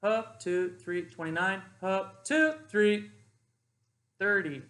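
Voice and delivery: climbing drill count that peaks on the last number; building cadence to last rep. Shown as a demonstration. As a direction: push the count up hard on the final rep. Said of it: "that's a two count exercise inflection".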